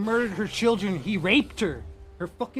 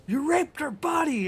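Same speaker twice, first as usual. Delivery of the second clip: retold battle scream; 6 semitones higher than usual. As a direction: yell it at the beaten foe, half-acted. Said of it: "and he's screaming it".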